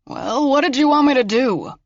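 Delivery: put-on high, indignant old-man voice; indignant protest. A narrator's reading. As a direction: protest as the scolded grandfather. Grandfather protesting after being scolded.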